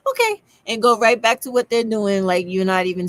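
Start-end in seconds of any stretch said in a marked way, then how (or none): 0.1-0.4 high, curt brush-off okay; dismissive okay. Retold or imitated. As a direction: wave them off with a short high okay. French clerk dismissing non-speaker.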